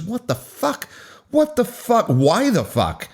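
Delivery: high-pitched, incredulous outburst; exasperated disbelief. A narrator's reading. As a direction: build from a high question to a drawn-out demand. Narrator's exasperated outburst at drama.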